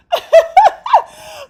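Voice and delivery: loud, high shrieking laugh; real explosive laugh at the joke; burst of laughter. Real, not acted. Shocked by Bud's big-head line.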